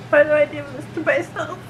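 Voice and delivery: normal talking voice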